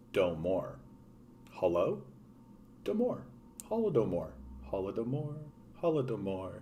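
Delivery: very soft self-rehearsal of Holodomor as "hollo-doe-mor"; pronunciation practice. Real, not acted. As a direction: test Domor and Holodomor quietly to yourself, varying the tune. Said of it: "can never fucking pronounce this correctly".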